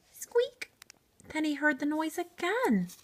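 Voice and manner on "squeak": tiny high mouse squeak; a short, very high squeak. Acted.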